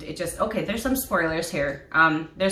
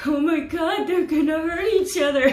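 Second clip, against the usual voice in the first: high-pitched, gasping worry over the characters; shrill-edged alarmed fan voice; panicked fan; 10 semitones higher, 4 dB louder than usual. Retold or imitated. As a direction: squeal it as if the scene is too much to bear. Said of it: "I was doing this".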